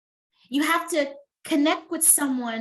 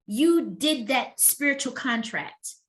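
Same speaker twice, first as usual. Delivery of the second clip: ordinary delivery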